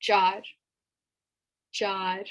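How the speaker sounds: "jar" with an open ah and a rolled r; "jar" with a Spanish-style r; Urdu number four. Shown as a demonstration. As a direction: model "jar", the r rolled at the end. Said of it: "number four is jar".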